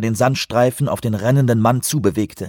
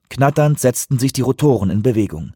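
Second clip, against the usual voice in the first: ordinary delivery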